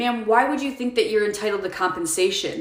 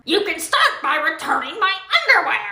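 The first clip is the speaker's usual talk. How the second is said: higher, bossy put-on customer voice; demanding customer; 7 semitones higher than usual. Customer demands cashier take her underwear back, bossy higher voice. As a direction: order her to hand it back.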